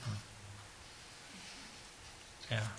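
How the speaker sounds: ordinary talk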